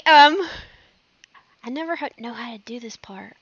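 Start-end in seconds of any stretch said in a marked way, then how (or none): 1.6-3.3 real hushed, self-conscious whisper; whispered aside. Real, not acted. Host unsure about this part, whispered aside. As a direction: whisper it off to the side.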